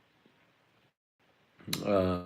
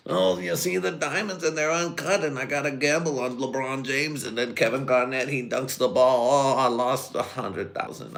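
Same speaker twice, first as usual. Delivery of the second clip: Adam Sandler gambler impression; 6 semitones higher than usual. Doing Sandler's Uncut Gems gambler, a higher whiny voice. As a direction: play the frantic diamond gambler losing money. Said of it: "if you've seen Uncut Gems".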